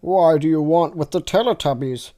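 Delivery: plain reading voice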